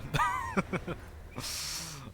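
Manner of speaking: long laugh starting high. Real, not acted. Amused every time he says it, long real laugh. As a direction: keep cracking up. Still amused by own line.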